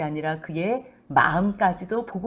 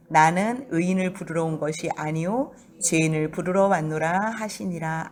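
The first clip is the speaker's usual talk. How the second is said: scripture reading; 5 semitones lower than usual. Acted.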